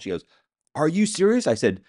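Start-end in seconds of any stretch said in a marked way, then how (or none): 0.7-1.5 high, louder mock disbelief; incredulous quote. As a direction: imitate her stunned question, pitched way up. Ex-wife's disbelieving reaction, acted.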